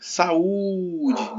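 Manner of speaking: saúde, leaning hard and long on the "ú"; dragged stressed syllable. Shown as a demonstration. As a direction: call out "saúde", stretching the middle "ú". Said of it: "Qual foi que você arrastou mais".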